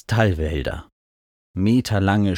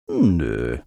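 low, unbothered no; casual refusal; 6 semitones lower than usual. A narrator's reading. Mount refuses while circling leisurely, casual. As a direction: drop it low and couldn't-care-less.

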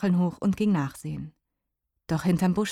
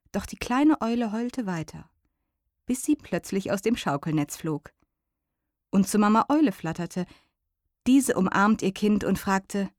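usual speaking voice

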